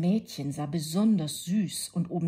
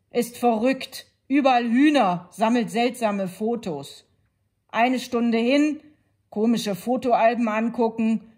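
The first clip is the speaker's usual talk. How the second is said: put-on louder, higher, grumbling child; bored, sulky girl listing complaints; 5 semitones higher, 9 dB louder than usual.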